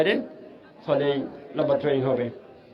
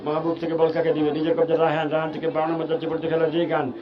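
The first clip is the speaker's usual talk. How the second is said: usual speaking voice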